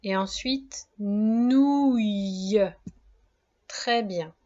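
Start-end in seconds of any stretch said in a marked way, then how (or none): 1.0-2.6 drawn-out dictation of the last syllable; slow, careful syllable for a child. Shown as a demonstration. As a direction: stretch nouille out slowly for spelling. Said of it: "Première syllabe".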